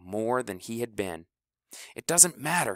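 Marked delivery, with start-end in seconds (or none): 2.0-2.8 higher, firm insistence; insistent. Acted. House insists to the team, higher and firm. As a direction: press the point. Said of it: "he said".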